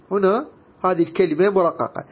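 usual speaking voice

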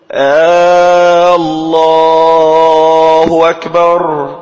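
loud, very slow muezzin chant, opening on a stretched آه; questioning hamza error. Shown as a demonstration. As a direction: chant الله أكبر with a long "aaa" before الله. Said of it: "أتى بهمز استفهامي".

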